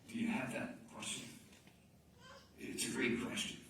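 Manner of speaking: soft, almost unvoiced; hushed confession. Real, not acted. Preacher admitting his own doubt to the congregation, hushed. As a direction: lean in and confide it.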